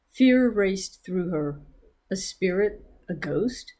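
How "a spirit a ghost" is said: usual speaking voice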